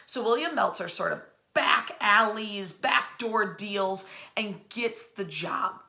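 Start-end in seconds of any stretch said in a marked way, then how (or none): none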